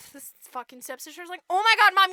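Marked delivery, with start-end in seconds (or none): 1.5-2.1 loud, high gush; mock delighted stepsister. Stepsister praises mom's plan, gushing loudly. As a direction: gush over a brilliant idea.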